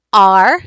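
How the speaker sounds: "R" as a full, loud ar vowel; clear, emphatic ar sound; bossy R sound. Shown as a demonstration. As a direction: say "R" isolated and held. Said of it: "here are the three basics".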